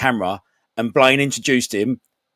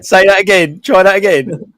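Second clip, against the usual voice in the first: loud teasing; 8 semitones higher, 7 dB louder than usual. Real, not acted. Teasing friend mispronouncing.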